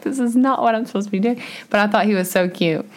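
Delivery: ordinary talk, nothing marked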